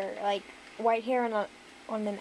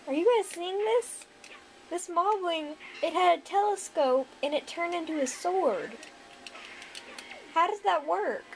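high, baffled disbelief; amazed and confused; surprised; 7 semitones higher than usual. Real, not acted. Moblin's telescope becomes sword.

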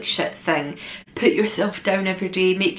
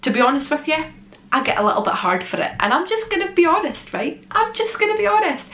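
high excited; 8 semitones higher than usual. Real, not acted. High pitched emphatic honesty.

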